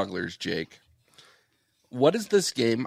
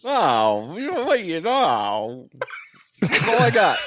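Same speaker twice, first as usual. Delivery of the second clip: high, loud, whiny mumble; Ray Romano impression; 7 semitones higher, 7 dB louder than usual. Friend attempting a Ray Romano impression on request. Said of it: "show him who Ray Romano is".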